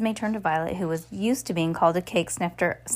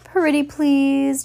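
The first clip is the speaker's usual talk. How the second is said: high, slow, louder whine; whining; 7 semitones higher, 4 dB louder, much slower than usual. A narrator's reading. Reporter begging Esme for a hint, whining. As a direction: drag it out like a begging child. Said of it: "Geraldine whined".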